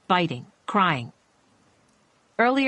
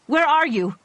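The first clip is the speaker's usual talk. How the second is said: loud, high call into the dark; frightened cry; 9 semitones higher, 4 dB louder than usual. Acted. Doctor calling to unseen shooter, loud frightened cry. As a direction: call out scared and searching. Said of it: "she cried".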